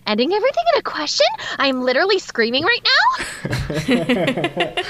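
every line ends on a high rising question; fast, sing-song valley-girl rise at each end; mocking the California question-ending lilt; mock uptalk. Shown as a demonstration. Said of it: "a question at the end of the statement".

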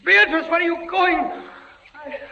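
high, pleading calls after her; desperate calling. Acted. Prisoner calling to his fading wife, high and desperate. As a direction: call her back as she fades. She fades away.

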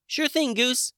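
high, bright fairy voice; cheery sprite voice. A narrator's reading. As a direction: chirp it like a tiny friendly sprite. Rachel voiced.

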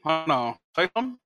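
choppy, glitchy robot-sounding mic; breaking up like a robot; robotic voice. Real, not acted. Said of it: "You sound like a robot".

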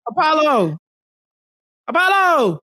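calling out. Acted. Calling out to Apollo.

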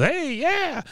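high-pitched mock cheer; a high, stretched hey-yeah; Bill. Podcaster acting out Bill at his kitchen game, high mock cheer. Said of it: "he is ... he goes".

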